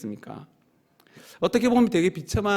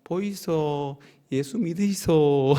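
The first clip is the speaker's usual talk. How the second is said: lightly voiced Busan dialect, slow on 믿으이소; Busan market call; much slower than usual. Shown as a demonstration. Pastor demonstrating the Jagalchi evangelism call, Busan dialect. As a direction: hawk the gospel like a Busan fish seller. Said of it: "자갈치 전도법으로 하면".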